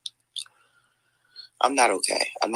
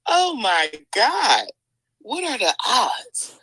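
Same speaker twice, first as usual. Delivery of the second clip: higher, slow, drawn-out amazement; stunned disbelief; 7 semitones higher, much slower than usual. Real, not acted. Vlogger overwhelmed by the twist, in slow high disbelief. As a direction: stretch out the shock. Shocked by the revelation.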